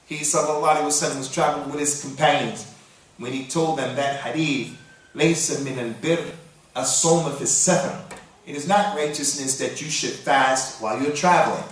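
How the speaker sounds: normal talking voice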